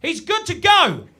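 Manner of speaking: loud, slow announcement to the room; called out loud. Retold or imitated. Costume head announcing his groin check, loud. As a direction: call it out across backstage. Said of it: "she just shouted out to everyone".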